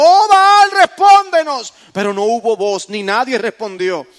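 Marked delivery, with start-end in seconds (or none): 0.0-1.7 high, loud desperate cry to an idol; grito de súplica. Acted. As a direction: call out to Baal with all your force. Profetas de Baal invocando a gritos. (clamor a gritos a Baal)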